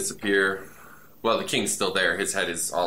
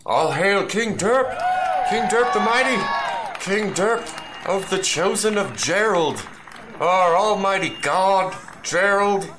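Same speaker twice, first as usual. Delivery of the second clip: high proclaiming shout; 12 semitones higher, 3 dB louder than usual. Acted. Crowd hailing King Derp.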